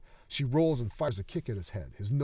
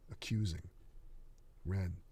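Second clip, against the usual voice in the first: ordinary delivery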